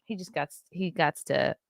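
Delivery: normal talking voice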